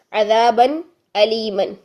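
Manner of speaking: measured Quran recitation, slow and deliberate. Shown as a demonstration. Teacher reciting the example verse ending for students, slow. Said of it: "مثال دی تھی عذابًا الیمًا".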